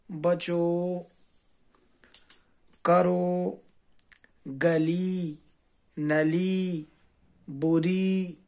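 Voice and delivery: ordinary talk, nothing marked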